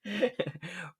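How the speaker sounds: helpless little laugh; amused laughter. Real, not acted. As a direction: crack up quietly at your own joke. Cracking up at own impression.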